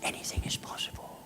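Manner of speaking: hushed, intimate whisper; soft whisper. Real, not acted. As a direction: lean in and whisper it. Intimate closing remark.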